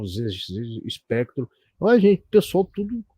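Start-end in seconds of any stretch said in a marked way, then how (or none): 1.8-3.0 bem mais agudo, chamando o pessoal animado; empolgado. Real, not acted. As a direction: vibrando com a nostalgia, voz lá em cima. Empolgado com os computadores antigos. (animado falando pro público da live, voz aguda)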